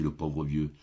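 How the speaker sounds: usual speaking voice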